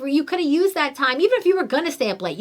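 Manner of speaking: ordinary delivery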